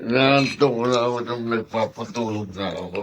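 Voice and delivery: lightly voiced tipsy old man; mild drunken ramble; drunk uncle. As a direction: slur along like a drunk uncle coming home. Lasing na naman siya.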